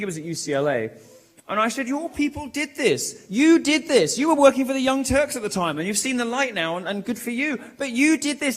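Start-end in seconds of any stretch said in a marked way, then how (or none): 1.9-8.6 retold accusation, raised pitch on each charge; accusing. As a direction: throw the blame at them, high and insistent. Retells accusing Rubin on stage.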